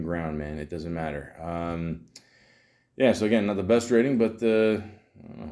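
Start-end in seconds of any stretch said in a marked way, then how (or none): 4.8-5.5 a breathless unvoiced whisper; whispered shrug. Real, not acted. Vlogger shrugging at the bad rating, whispered. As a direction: whisper it as an aside.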